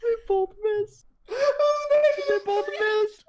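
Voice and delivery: mock crying. Dice roll fails, mock lament.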